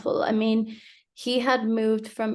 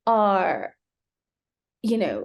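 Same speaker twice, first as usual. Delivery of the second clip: normal talking voice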